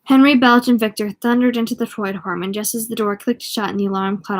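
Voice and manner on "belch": usual speaking voice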